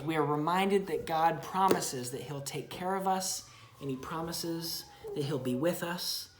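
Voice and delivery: ordinary delivery, nothing marked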